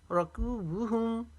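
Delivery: रकूबुहुम chanted slowly, कू held one alif long; slow Quranic chant of रकूबुहुम; tajweed recitation. Shown as a demonstration. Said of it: "कू को एक अलिफ के जितना पी-, खींचेंगे".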